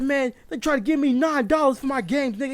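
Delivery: high-pitched indignant complaint; mad. Retold or imitated. Customer complaining to his friend about nine dollars, mad. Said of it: "Why you so mad".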